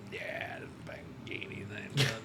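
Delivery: whispered, low-key retold aside; hushed imitation. Friend dismissing the Lamborghini, imitated in a hush.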